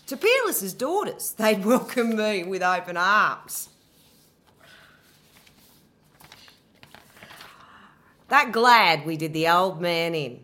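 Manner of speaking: Medea's fury. Acted. Medea accusing Jason, furious and desperate. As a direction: hurl every betrayal back at him.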